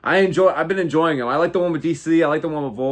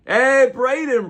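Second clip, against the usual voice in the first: calling out; 11 semitones higher, 6 dB louder, much slower than usual. Real, not acted. Calling to a chatter.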